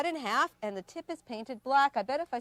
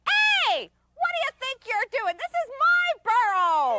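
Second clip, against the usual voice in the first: much higher, loud indignant critter voice; squeaky chipmunk; 12 semitones higher, 5 dB louder than usual. Acted. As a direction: squeak at the intruder from your burrow.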